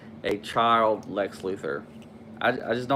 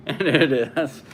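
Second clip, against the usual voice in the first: laughing; 4 semitones higher, slower than usual. Real, not acted. Laughing at his own joke.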